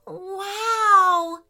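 high, loud, drawn-out awe; amazed. Acted. Three friends seeing the treasure, loudly amazed. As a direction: gasp it out in wonder. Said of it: "said the three friends together".

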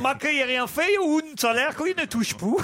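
lightly put-on Italian accent; mock Italian accent. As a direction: ham up an Italian accent that slips toward Spanish. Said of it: "c'est un peu de l'espagnol".

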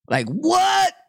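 shrieking high in disbelief; a sudden high squeal of disbelief; incredulous what. Real, not acted. Crowd footage stuns the vlogger, high incredulous squeal.

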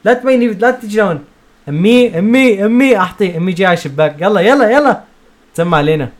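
loud high urgent calling. Retold or imitated. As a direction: call out urgently. Retelling urgent call to mom.

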